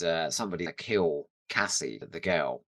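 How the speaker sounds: ordinary delivery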